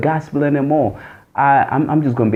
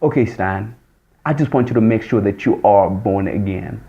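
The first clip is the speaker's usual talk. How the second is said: inner voice of God; 6 semitones lower than usual. Retold or imitated. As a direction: speak as the still small voice. God's inner voice again.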